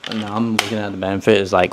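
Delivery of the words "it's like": ordinary delivery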